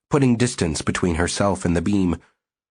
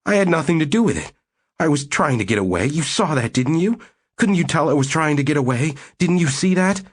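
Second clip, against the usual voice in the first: fast, higher, whining pleading; plaintive whine; 8 semitones higher than usual. Acted. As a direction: babble excuses in a whine. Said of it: "plaintive, whining tone".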